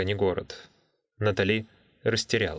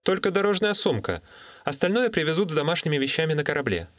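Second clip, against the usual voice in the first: put-on higher, quick young woman; brisk young woman, higher; 6 semitones higher, faster than usual. Natalie on the phone.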